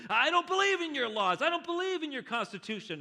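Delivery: high, loud defiant yell. Acted. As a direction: shout it at the judge. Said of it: "Richard yells out".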